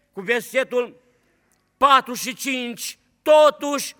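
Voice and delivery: loud, ringing sermon delivery; preacher raising his voice to the congregation; full-force preaching. Acted. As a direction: preach it out to the whole church.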